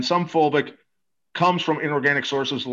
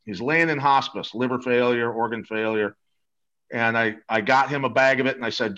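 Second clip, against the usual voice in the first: normal talking voice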